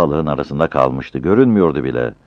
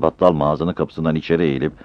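usual speaking voice

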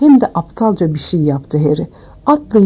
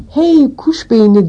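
high, drawn-out call across a room; narrated shout; 6 semitones higher, slower than usual. A narrator's reading. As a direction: call out the taunt slowly, pitched up. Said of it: "diye bağırarak".

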